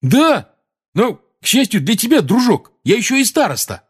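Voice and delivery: high, loud, defiant; indignant, pulling rank; heated retort. A narrator's reading. Ron reminding Seamus he is a prefect, heated.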